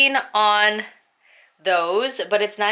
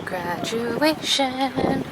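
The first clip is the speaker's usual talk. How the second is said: excited drawl; 6 semitones higher, much slower than usual. Real, not acted. Drawn-out exclaim at ceremony.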